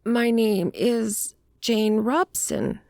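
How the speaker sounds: high, slow, hesitant woman's voice; evasive woman. A narrator's reading. Woman giving detective an alias, high and hesitant. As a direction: give the name slowly and evasively, pitched high. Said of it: "answered with a sidelong glance".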